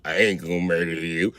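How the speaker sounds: put-on low, slow, creepy kid voice; slow talking. Dad imitating his son's slow creepy talk. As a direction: drag it out low and eerie. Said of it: "see how he talking all slow?".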